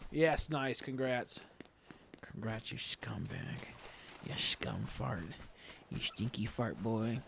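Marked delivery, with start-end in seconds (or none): 2.2-7.2 a hint of a low, put-on teasing voice; mock insult. Teasing Nora over her shiny catch, in a low joking put-on voice. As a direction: tease her in a lightly voiced gruff put-on.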